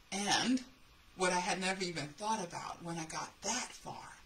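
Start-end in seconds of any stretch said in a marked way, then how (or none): none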